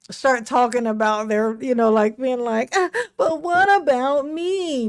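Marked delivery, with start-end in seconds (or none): 2.7-4.9 whiny fake sob, slowed and pitched up; mock whine. Mocking wrongdoers who whine about their own suffering with a fake sob. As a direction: mimic a wrongdoer sobbing over themselves.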